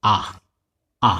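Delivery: আ as the sound of u; short a sound. Shown as a demonstration. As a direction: say আ short and open, twice. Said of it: "এর সাধারণ phonetic সাউন্ডটা হচ্ছে".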